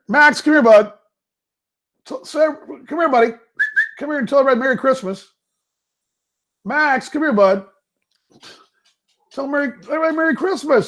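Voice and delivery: high, coaxing voice to call the dog. Real, not acted. Owner calling his dog Max over, high and coaxing.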